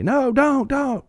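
high squealing don't; mock panic. Commenters pleading with David not to go, high mock panic. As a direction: squeal it in hammed-up alarm.

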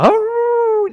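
loud, high, drawn-out howl; dog howl. Retold or imitated. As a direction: imitate a dog howling in pain. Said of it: "you're howling".

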